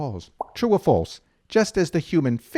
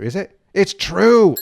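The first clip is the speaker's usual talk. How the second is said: ordinary talk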